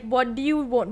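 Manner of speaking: ordinary talk, nothing marked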